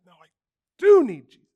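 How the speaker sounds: "do" punched high and loud in "I do need Jesus"; a ringing "do" in "No, I do need Jesus"; stress on DO. Shown as a demonstration. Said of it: "you gotta bring that".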